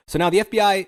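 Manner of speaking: ordinary delivery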